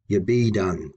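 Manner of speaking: ye BE done, quick light ye; stress on be done. Shown as a demonstration. Teacher showing unstressed prefix, stress on be done. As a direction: pass over ye and lean on be. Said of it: "I pronounced be done with more intensity".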